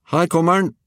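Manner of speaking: put-on high, loud boy's call; excited call. A narrator's reading. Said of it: "ropte Georg".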